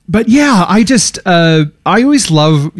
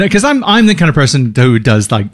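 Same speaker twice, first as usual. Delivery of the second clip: ordinary talk, nothing marked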